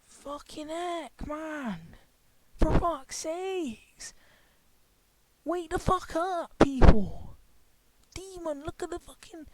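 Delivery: slow, loud exasperated groaning rant. Real, not acted. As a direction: drag the words out in disbelief. Exasperated outburst at viewers.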